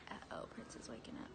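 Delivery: breathy whispered aside, barely voiced. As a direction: whisper it like a secret joke. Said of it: "I was like".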